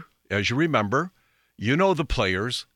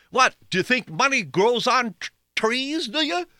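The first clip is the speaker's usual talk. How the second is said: high-pitched mock parental scolding; 11 semitones higher than usual. Parent chiding a child about money, high mock-exasperated voice. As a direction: imitate a parent's incredulous money lecture. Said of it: "I heard this one quite a bit too".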